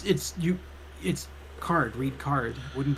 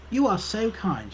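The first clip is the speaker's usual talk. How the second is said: higher-pitched grateful British librarian; 6 semitones higher, 4 dB quieter than usual. Acted. Librarian flatters the helpful visitor, British character voice. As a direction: gush politely as the British librarian. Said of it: "she says".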